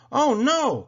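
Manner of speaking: high, loud cry of alarm; Thomas, crying out. A narrator's reading. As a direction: cry out in dismay. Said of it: "cried Thomas".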